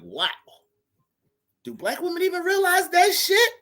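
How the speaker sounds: very high, amazed disbelief; astonished. Retold or imitated. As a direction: jump way up in disbelief. His own astonished reaction, quoted.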